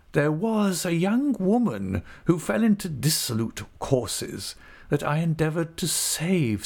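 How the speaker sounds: a sanctimonious valet preaching forgiveness; pious manservant. A narrator's reading. Littimer piously asking the gentlemen for help in a high unctuous voice.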